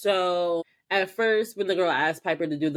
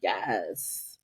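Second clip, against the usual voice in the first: sassy possessed Piper; 3 semitones lower, slower than usual. Retold or imitated. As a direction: savour the new body, then sneer. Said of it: "she's just like".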